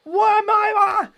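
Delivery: very high, slow strained wail; exasperated cry. Real, not acted. Fan losing it over the broadcast, high strained wail. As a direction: voice cracking up high in disbelief. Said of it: "I'm losing it".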